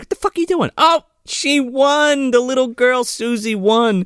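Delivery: high, startled outburst; shocked mayor's wife. Retold or imitated. The mayor's wife finding the girl, high and scandalized. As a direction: squawk it like a scandalized lady. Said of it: "She's like".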